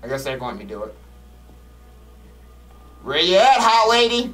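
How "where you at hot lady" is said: mock calling out. Calling out to the ghost.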